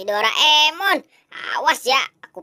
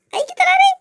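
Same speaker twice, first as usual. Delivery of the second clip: much higher, squeaky dash; squeaky panic; much faster than usual. Acted. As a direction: squeal it as you bolt. Fleeing with stolen toys. (suara cicit panik)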